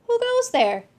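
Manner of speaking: startled guard call; alarmed challenge. Acted. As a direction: call out into the dark.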